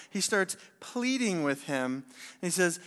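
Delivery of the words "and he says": normal talking voice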